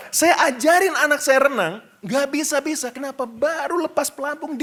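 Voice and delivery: ordinary talk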